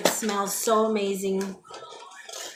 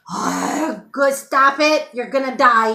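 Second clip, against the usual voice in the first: loud sudden yell at the pet; alarmed shout; 4 semitones higher, 10 dB louder, slower than usual. Real, not acted. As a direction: shout a startled warning. Scolding the cat near cords.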